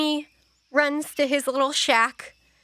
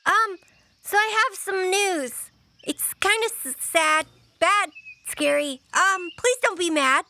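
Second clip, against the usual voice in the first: put-on a touch higher, hesitant kid; lightly voiced child, nervous; 7 semitones higher than usual. Child confessing bad news to elders, hesitant.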